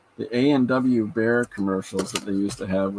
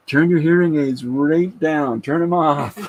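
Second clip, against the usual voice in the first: slow, raised, higher call to a friend; mock warning call; 7 semitones higher, 6 dB louder than usual. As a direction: bark it like a cannon crew warning. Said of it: "he says to John".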